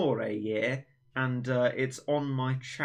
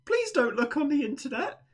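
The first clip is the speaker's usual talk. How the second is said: mock teacher voice; 14 semitones higher than usual. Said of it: "be like".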